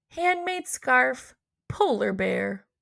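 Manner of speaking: plain reading voice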